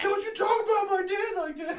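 high, loud laughing mockery of an indignant kid; mock protective son. Friend parodying the guy defending his dad in a high, laughing voice.

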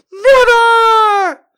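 high, loud, shrieked refusal; defiant scream. Acted.

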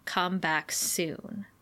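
soft, very slow, trailing low; quiet sign reading. Retold or imitated. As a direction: read it slowly and softly, letting it sink. Reads the town sign aloud.

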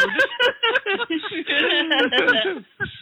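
high bubbling real laugh. Real, not acted. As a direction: let the laugh ring out. Caller laughs after refusing.

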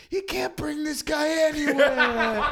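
high, loud, drawn-out heckle breaking into laughter; mock exasperated shout. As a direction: imitate a friend loudly roasting someone. Quotes friend's mocking call-out.

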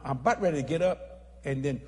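ordinary talk

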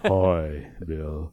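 deep, low robotic speech voice; low, flat synthesized voice; deep Stephen Hawking. Retold or imitated. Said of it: "deep Stephen Hawking".

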